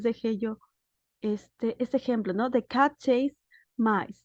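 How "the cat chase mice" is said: drawn-out, careful example read; slow classroom read-aloud; slow dictation. Shown as a demonstration. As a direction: read it slowly so students follow. Teacher reads example sentence.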